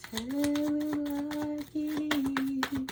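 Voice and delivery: drawn-out exclaim. Real, not acted. Tarot reader drawing out phrase.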